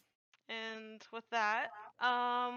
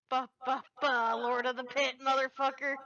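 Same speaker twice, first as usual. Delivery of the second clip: triumphant; 2 semitones higher, 8 dB louder than usual. Real, not acted. Slamming a big creature down.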